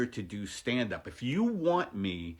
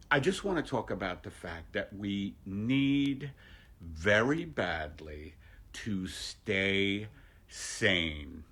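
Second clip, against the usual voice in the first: ordinary delivery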